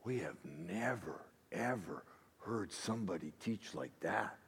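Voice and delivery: very soft, low amazement; hushed, low, as if floored; awed hush. Acted. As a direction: murmur it in stunned wonder. People's awed reaction to the teaching.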